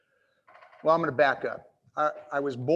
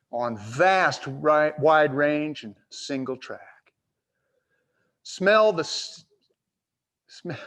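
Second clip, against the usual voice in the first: cowboy poetry; 4 dB louder, slower than usual. Acted. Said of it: "I wanna share a few lines for Oregon".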